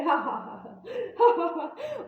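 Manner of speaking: mock hearty laughter, ha ha ha; imitating dad's laugh. Daughter mimics her father laughing at her on the call. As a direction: ham up the father laughing down the phone. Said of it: "फिर हंस रहे".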